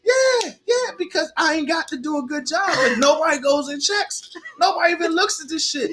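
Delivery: high, laughing excitement; cracking up with glee; excited. Real, not acted.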